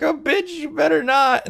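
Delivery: very high put-on comeback; hammed-up high-pitched squabbling; mock sassy warning. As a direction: warn back in an exaggerated falsetto. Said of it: "And then the guy's like".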